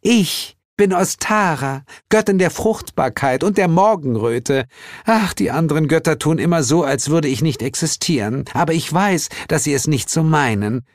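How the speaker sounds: high, proud, gushing introduction; self-pleased sugary goddess; beaming spring goddess. A narrator's reading. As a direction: present yourself grandly in a sweet high voice.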